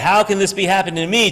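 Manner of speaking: the complaining version; despairing lament. Retold or imitated. Person lamenting before being helped, despairing. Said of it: "they change that".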